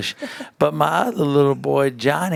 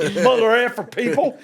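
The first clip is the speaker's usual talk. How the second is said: mock Brett; 8 semitones higher than usual. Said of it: "Brett's like".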